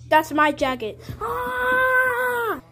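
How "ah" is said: sustained high-pitched "ah"; long high scream. Real, not acted. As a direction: hold a long, high cry. Cries out over jacket.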